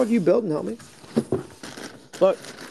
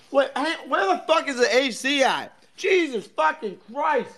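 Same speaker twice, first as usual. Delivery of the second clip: sudden outburst; 9 semitones higher, slower than usual. Real, not acted. Suddenly noticing the cold room.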